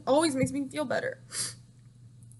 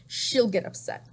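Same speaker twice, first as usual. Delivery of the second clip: ordinary talk, nothing marked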